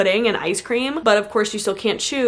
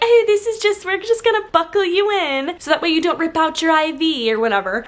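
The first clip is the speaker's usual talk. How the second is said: high mock nurse voice; 7 semitones higher than usual. Mimicking nurse strapping her down.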